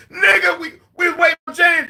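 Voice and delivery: high, loud, half-crying shout; mock terror while telling the story; panicked cry. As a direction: yell it like you're scared out of your wits. Scared, waiting for James.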